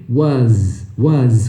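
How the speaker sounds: WAS low, as "wuhzzz"; exaggerated correct pronunciation. Shown as a demonstration. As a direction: lean on the buzzing Z of WAS. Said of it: "Ó, faz aí comigo".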